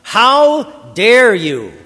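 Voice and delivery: slow, loud, high-pitched furious shouts; angry screamed commands. Acted. Slave master screaming commands at the slave in anger, furious drawn-out shout. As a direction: scream each command, dragging out the words. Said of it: "He screams his commands at you with anger in his voice".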